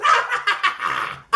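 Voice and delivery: high-pitched giggly laugh; high cackle. Pretend. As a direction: cackle high after a silly goodbye. Laughs after the sign-off.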